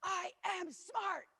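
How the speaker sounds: put-on high, slow child's shout; proud little girl. Lily's earlier stomp routine retold, slow high child shout. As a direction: shout it out like a proud kid, slowly. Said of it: "didn't do this".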